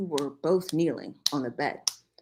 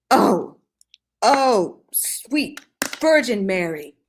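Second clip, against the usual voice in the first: loud, high, slow wailing gasp; shocked Korean mother; 4 semitones higher, 8 dB louder, slower than usual. Acted. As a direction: cry out to the Virgin in horror.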